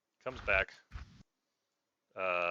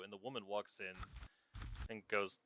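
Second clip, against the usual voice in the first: usual speaking voice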